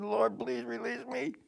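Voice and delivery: high, soft begging plea; lightly voiced pleading apostle; pleading Paul. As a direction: beg God for release in a thin high voice. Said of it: "No, he said".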